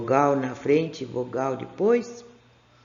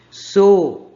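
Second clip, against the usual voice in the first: loud and higher, each syllable clear; loud reading; 3 semitones higher, 12 dB louder than usual. Shown as a demonstration. Teacher calls out the brown word to pupils, loudly. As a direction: call the word out to the class. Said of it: "A marronzinha aqui".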